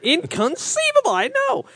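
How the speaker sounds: very high, thin squeak; squeaky high voice. Retold or imitated. Echoing Princess Bride quote.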